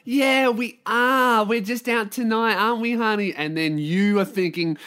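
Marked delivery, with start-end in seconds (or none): 0.0-3.3 put-on gushing girlfriend. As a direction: coo sweetly to a partner. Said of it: "your girl will go".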